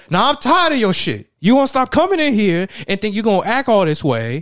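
loud, high, heated call-out; angry yell. Retold or imitated. Dennis stepping in and shouting down the football player. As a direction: tell him off, ready to fight.

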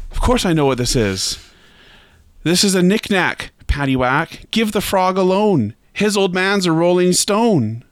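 high, insistent punchline delivery; emphatic bank manager. Acted. Manager delivers the shaggy-dog punchline.

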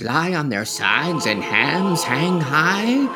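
high, eager little mouse voice. Acted. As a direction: squeak it out like a curious mouse.

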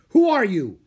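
forceful interrogating shout; barked demand. A narrator's reading. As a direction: bark it like a warrior on guard. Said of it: "one of the samurai barked".

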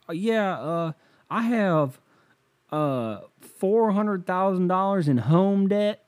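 high, halting caller confessing debts; hapless caller. Retold or imitated.

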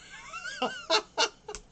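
sudden high laughter; high burst of laughter. Real, not acted. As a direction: crack up laughing, pitch way up. Laughing at own mix-up.

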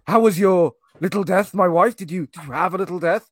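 overdone aristocrat addressing his dear wife; hammed-up posh husband. Husband asks his wife about the night, in a loud, high, pompous voice. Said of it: "literally, what he says is".

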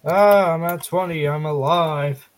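usual speaking voice

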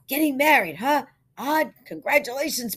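high, loud, jovial ribbing; heartily razz the groom; hearty congratulations. A narrator's reading. Barbers splash Odd in the tub, jovial congratulations.

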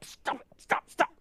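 whispered, pleading stop; hushed plea. Retold or imitated. Dad hushing kids in the car, whispered plea. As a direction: hush them, barely voiced. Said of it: "I'm like".

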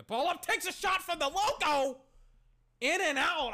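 high, loud hype call; excited play-by-play. Real, not acted. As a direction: call the logo shot like a broadcaster.

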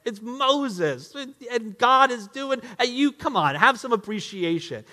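slow, mocking scold of spoiled brats; a preachy, sneering put-down; condescending scolding. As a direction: talk down to them like naughty kids. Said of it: "it's very easy to be condescending towards them".

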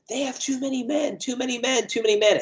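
high-pitched, rising mock yell from the coaches; angry shout. Coaches screaming at the referee: high, building angry yell. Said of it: "they are angrily shouting this".

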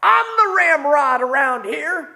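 mock bossy shout, throwing his weight around; retold yell. Coworker berating him in a hot truck, mock yelling. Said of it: "starts yelling at me and he says".

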